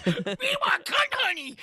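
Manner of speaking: high, slow sing-song commercial chant; cereal mascot. Acted. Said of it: "it's like a cereal commercial".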